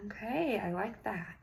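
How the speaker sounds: ordinary talk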